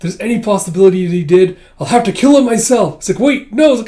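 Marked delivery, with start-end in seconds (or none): 0.0-3.0 high, loud mock threat; acted outburst. Manga fan acts out a character's threat, loud outburst. 3.2-3.7 high, loud mock protest; panicked protest. Manga fan acts out a character objecting, loud mock panic.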